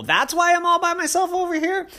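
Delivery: put-on high wondering child; little girl voice. A narrator's reading. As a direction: realize it like a kid. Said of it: "Poppy said".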